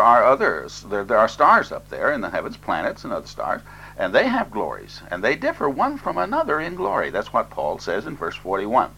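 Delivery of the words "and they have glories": usual speaking voice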